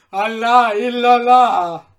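booming invocation in astonishment; amazed exclamation. A narrator's reading. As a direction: call it out at full voice.

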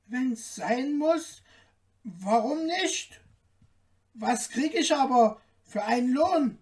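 high tailor voice, questioning lilt; high little man haggling; little tailor, hesitant. A narrator's reading. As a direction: ask in a small, high voice.

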